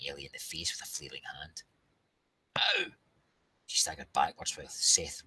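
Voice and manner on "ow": yelp of pain. Acted. Hit in the face.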